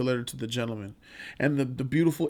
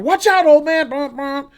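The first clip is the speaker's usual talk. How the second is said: high, loud angry yell; hammed-up rude driver; 11 semitones higher, 7 dB louder than usual. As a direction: shout at the old man from the car. Driver yelling at Brooks.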